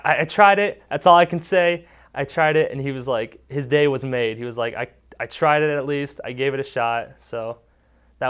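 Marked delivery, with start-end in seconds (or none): 0.0-2.7 high, loud proud announcement; thrilled. Retold or imitated. Boy back from the climb to counselors, a high, loud thrilled boast. 4.7-7.1 louder, happy boast; proud. Retold or imitated. Boy back on the ground to counselors, a happy, loud proud boast.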